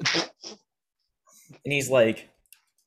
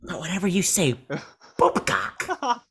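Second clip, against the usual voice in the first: hammed-up Joker, jumping high on the key words; 6 semitones higher than usual. Podcaster retrying the Joker speech for a friend, hammed-up Joker. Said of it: "my Joker voice is better".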